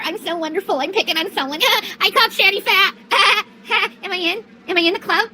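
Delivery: high, squeaky mock bully. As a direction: sneer as a needy bully. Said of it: "look how wonderful you are".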